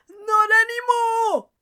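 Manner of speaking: high, loud flat refusal; firm shout. A narrator's reading. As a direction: shut him down hard. Cop shuts down argument.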